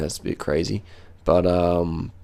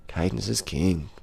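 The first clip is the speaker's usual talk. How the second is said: softer and slow, drifting off; slow, softer repeat like a mantra; soft slow echo; 2 semitones lower, 9 dB quieter, slower than usual. Shown as a demonstration. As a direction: murmur it back to yourself, slowly. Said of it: "repeats phrase softer, slower".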